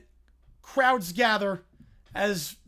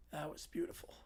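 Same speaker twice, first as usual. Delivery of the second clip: whisper; 9 semitones lower, 18 dB quieter than usual. Real, not acted. Awed reaction after the story.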